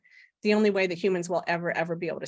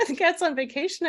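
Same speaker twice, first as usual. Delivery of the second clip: higher-pitched, disbelieving question; mock astonishment, pitched up high; incredulous echo; 9 semitones higher than usual. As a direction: repeat it back as a baffled question.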